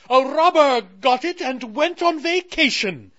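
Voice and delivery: high, loud indignant queen voice; a royal complaining about a thief; Moon Queen. Acted.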